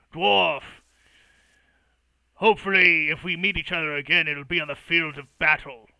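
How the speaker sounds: loud, blunt warrior's parting words; gruff orc farewell. Acted. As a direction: growl a loud challenge. Orc bids rival farewell.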